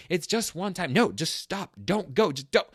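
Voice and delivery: yelling at myself. Retold or imitated. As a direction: argue with yourself like a madman at the wheel. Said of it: "I sound like an insane person going".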